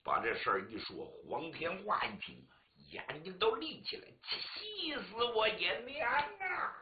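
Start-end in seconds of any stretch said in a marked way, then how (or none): 4.2-6.7 high, slow, drawn-out lament; anguished wail. Acted. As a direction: son wailing for his dead mother. Son hears mother died, wails.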